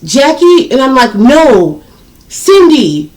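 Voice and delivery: heated name exchange. Retold or imitated. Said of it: "She was like".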